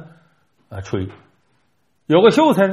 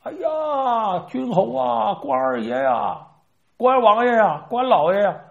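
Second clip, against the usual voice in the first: calling out higher, a drawn-out plea; mock plaintive call; 8 semitones higher, slower than usual. Old turtle under the stone tablet calls out to Guan Gong, high pleading wail. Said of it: "就喊".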